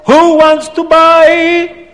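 high, loud auctioneer's bellow; acted angry, accented sales cry; angry Abdallah. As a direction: call it out indignantly, stretching the ends. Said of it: "Abdallah starts to get angry".

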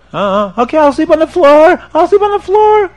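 high, loud mock wail; crying penitent. Sinner pleads with the rabbi, hammed-up crying. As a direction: wail like a desperate, guilt-ridden man. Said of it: "the man is crying and screaming".

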